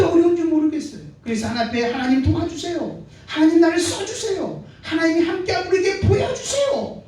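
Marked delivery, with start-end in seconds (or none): 1.9-7.0 rising, ever higher cry to God, ending in a shout; pleading prayer. Acted. Believer crying out to God in hardship, building pleading shout. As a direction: build the plea until it breaks into a shout. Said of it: "라고 기도하는데".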